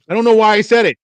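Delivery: loud, high-pitched self-reproach; exasperated outburst. Retold or imitated. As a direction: blurt it out, baffled at yourself. Exasperated at own retold blunder.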